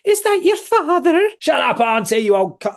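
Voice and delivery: high, loud fussing auntie voice; Scottish auntie. Acted. Mimicking the Scottish auntie from the film, high and shrill-sounding loud. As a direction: flutter like a nosy Scottish auntie. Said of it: "the Scottish auntie who's, like, fluttering around".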